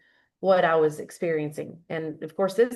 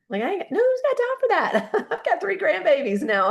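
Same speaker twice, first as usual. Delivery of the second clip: high squeaky no-way voice; mock exasperated; 10 semitones higher than usual. Telling husband no long plan, high mocking squeal. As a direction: toss it off in a high mocking pitch. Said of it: "And I said".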